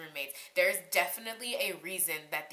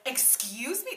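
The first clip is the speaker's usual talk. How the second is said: indignant, higher, rising question; mock-outraged excuse-me; retold angry disbelief; 7 semitones higher, slower than usual. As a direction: imitate being offended and challenging her. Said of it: "I was so angry".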